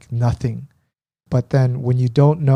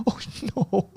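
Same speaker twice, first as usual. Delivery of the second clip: mock dismay; 7 semitones higher, 7 dB quieter than usual. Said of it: "I go".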